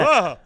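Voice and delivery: loud, high mock scream of surprise; startled yelp. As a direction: yelp as if someone just popped up behind you. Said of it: "he's very startled by the fact".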